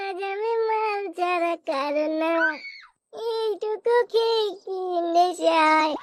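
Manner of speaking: put-on slow, drunken slur with a sob in it; drunk slurring. A drunk son, thrown out by his father, slurring and weepy. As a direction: drag the words out like a weepy drunk.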